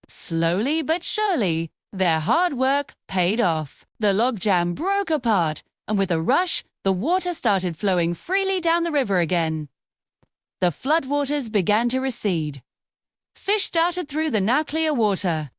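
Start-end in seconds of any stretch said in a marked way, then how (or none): none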